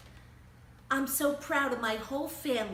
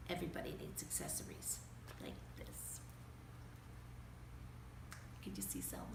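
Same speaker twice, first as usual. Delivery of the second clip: fast unvoiced whisper, much softer; whispered aside; 13 semitones lower, 13 dB quieter, faster than usual. Reader showing the kids the page, whispering fast. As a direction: rush it out under your breath.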